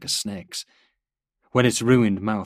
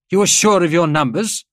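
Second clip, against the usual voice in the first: high, loud questioning; sharp query; 11 semitones higher, 11 dB louder than usual. A narrator's reading. General doubting the major's numbers, loud query. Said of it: "Gruber's voice queried".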